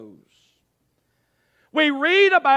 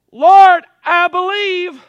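loud, high declaration to God; loud cry of faith; 6 semitones higher, 12 dB louder, slower than usual. Acted. Preacher cries out the prayer.

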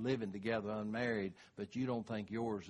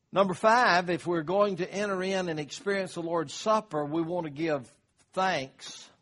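rising, louder, higher preacher's build; preaching; 4 semitones higher, 5 dB louder than usual. Acted. Pastor urging the congregation before communion, preaching hard. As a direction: climb to full pulpit force.

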